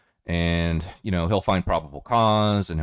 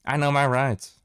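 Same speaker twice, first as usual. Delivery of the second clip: higher, louder smug voice; mocking know-it-all; 9 semitones higher, 5 dB louder than usual. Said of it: "Anybody that ever says that".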